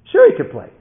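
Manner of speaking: high, loud enthusiastic yes; brightened. Retold or imitated. Team captain welcomes the man's son, loud and brightened. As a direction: agree eagerly at full voice. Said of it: "he brightened".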